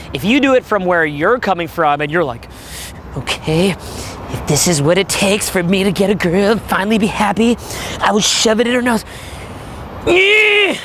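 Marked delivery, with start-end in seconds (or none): 3.2-9.0 strained guy talking himself into it; forced resolve, trying to be happy; reluctant guy. Acted. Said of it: "If you're gonna do that". 10.1-10.8 forced high-pitched "eh"; awkward strained yelp; high strained squeal. Acted. Mimics forced, inhibited attempt.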